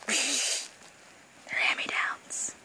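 low, slow whispered aside. Real, not acted. Fan sharing a secret about Terra's pants, slow whisper.

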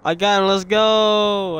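loud, high victory yell; triumphant gamer shout; excited celebration. Real, not acted. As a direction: whoop it out, stretching the go. Celebrates a kill in the game.

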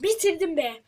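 ordinary talk, nothing marked

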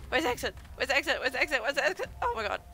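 loud, frantic repeated cries; panicked. Real, not acted. Player searching for the cave exit, loud panic.

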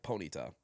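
"Ponita" low and evenly weighted; Paul's pronunciation. Retold or imitated. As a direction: say "Ponita" low, without punching "nee". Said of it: "that's what Paul says".

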